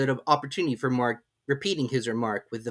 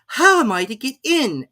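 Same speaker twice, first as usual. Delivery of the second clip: high, louder, insistent; louder Alice; 13 semitones higher, 8 dB louder than usual. A narrator's reading. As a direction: press the question harder. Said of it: "in a louder tone".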